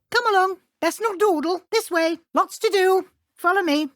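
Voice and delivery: bright teacher herding a student along; brisk urging. Acted. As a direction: chivvy them onward, eager and brisk.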